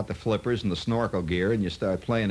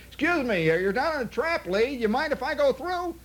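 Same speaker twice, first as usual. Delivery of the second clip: much higher, exaggeratedly polite call; mock polite holler. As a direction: call up sweetly, overdoing the politeness. Asking Trevino to play through.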